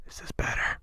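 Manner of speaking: hushed, muffled by the hand; muffled mic. Shown as a demonstration. Testing mic muffling for the impression, muffled and soft. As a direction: cover the mic and ask quietly. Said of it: "Muffle the mic".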